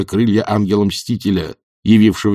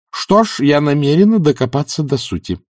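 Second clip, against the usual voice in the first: higher-pitched, resolute retort; curt, determined; 6 semitones higher than usual. A narrator's reading. Matushka resolves to investigate the stage, cutting the talk short. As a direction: cut off the discussion firmly. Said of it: "отрезала матушка".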